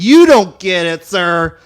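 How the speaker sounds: loud, slow sassy brag; loud drawn-out diva sass. Retold or imitated. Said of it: "And I was like".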